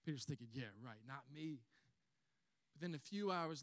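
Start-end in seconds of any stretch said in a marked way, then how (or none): 0.5-1.6 low, quiet scoff to himself; softly skeptical aside; dismissive thought. Retold or imitated. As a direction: brush it off under your voice. Acts Peter's dismissive private thought.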